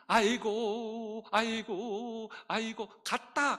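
slow, drawn-out mourning wail; demonstrating a hired mourner's lament; ritual wailing. Shown as a demonstration. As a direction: stretch each 아이고 like a hired mourner. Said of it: "어떻게 울어요?".

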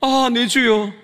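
anguished outcry. Retold or imitated. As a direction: call out to God in retold despair. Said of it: "그래서 그는 외친 거죠".

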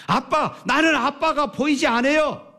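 put-on high, pleading child's voice; crying child answering. A narrator's reading. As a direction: answer like a boy in tears. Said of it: "아이는 울면서 대답합니다".

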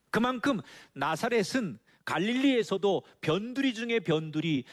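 ordinary talk, nothing marked

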